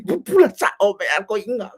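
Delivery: talking through laughter; words breaking up into giggles; laughing. Real, not acted. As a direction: laugh while getting the words out. Laughs at own remark.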